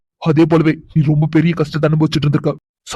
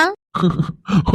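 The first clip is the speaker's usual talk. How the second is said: amused laugh; 4 semitones higher, 4 dB quieter than usual. Acted. Husband laughs again.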